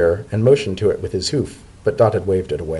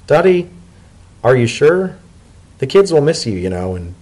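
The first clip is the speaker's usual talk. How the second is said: higher, pleading Leafy voice; reluctant Leafy; 5 semitones higher, 4 dB louder than usual. Acted. Leafy urges Dotted to come, reluctant. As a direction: gently press him to reconsider. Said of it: "Reluctantly, he spoke".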